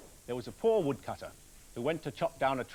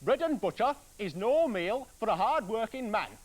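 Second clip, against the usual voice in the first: louder, higher angry husband; shouting woodcutter; 7 semitones higher, 7 dB louder than usual. Acted. Cross woodcutter shouts at his wife about dinner. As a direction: bellow it across the table. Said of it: "the woodcutter shouted".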